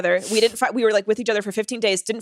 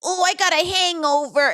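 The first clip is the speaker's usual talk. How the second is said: put-on childish whine; 8 semitones higher than usual.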